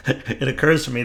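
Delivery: laughing. Real, not acted. Laughing at own mistake.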